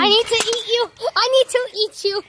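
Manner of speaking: alien voice effect; voice changer alien. Pretend. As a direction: talk through a voice changer. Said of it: "he's an alien now".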